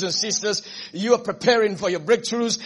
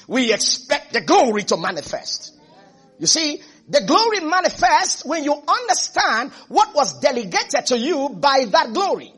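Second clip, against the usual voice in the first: ordinary talk, nothing marked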